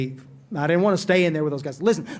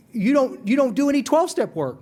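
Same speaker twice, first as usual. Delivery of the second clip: higher-pitched, accusing disbelief; mock incredulous challenge; 6 semitones higher than usual. Sponsor challenging a man about his step work, incredulous. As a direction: challenge him as if it's absurd. Clifford challenges him in retold exchange.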